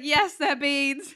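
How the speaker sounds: slow, laughing confirmation; teasing the waiter, laughing. Retold or imitated. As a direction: laugh through the words. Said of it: "And I was like".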